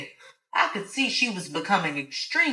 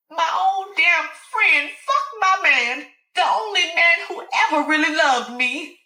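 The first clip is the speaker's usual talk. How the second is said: betrayed Alice; 11 semitones higher, 5 dB louder than usual. Acted. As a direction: spit out every word of betrayal.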